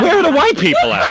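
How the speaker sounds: high, laughing mock confusion; mock bewildered. Host joking to the callers, mock bewildered and laughing. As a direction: play dumb with a laugh in it.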